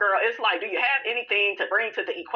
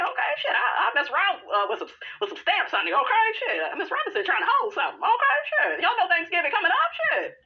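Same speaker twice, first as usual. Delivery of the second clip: shrill high character voice; 9 semitones higher than usual. Pretend. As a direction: screech it like a sassy auntie.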